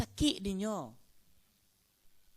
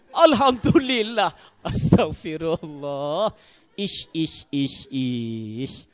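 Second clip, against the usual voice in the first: loud exclamations; 3 semitones lower, 12 dB louder, much slower than usual. Real, not acted. Congregation reacts to bad husbands.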